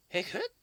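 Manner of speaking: sharp squeaky hiccup; hiccup sound. Acted. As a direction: pop out a little hiccup. Character has the hiccups.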